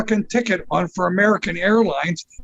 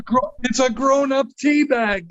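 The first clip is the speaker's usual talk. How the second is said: words bubbling with laughter, much higher; laughing through the punchline; 6 semitones higher than usual. Real, not acted. Telling the listener about grown-up Teabag, laughing. Laughing at his own story.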